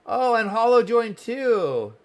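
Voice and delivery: bright delighted exclamation, slow; excited. Real, not acted. Streamer delighted another viewer joined, excited. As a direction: greet the newcomer with a lift.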